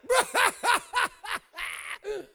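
high staccato cackle; high, rapid mock laughing fit; imitated laughing fit. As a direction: re-enact bursting into helpless laughter. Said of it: "I started laughing".

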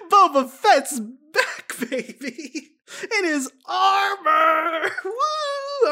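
high, slow, whooping with joy; excited cheering. Real, not acted. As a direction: cheer like your hero just returned. Cheering Boba's return.

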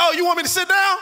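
high, loud mocking shout; taunting shout. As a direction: throw it back in his face, loud. Fan taunting the angry man.

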